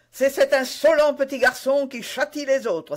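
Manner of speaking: loud, high retort; indignant cry. A narrator's reading. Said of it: "s'écria-t-il".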